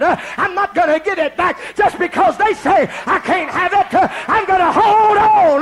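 high, loud, slowed defiant preaching; full-force preaching. Real, not acted. Preacher defying the bigoted doubters, shouted preaching. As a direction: declare it to the doubters with fire.

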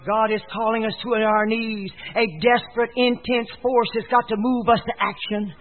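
ordinary talk

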